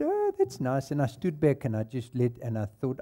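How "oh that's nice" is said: sarcastic high sing-song; a falsetto fake approval; mock sweet reply. Mock reaction to Francois' plans.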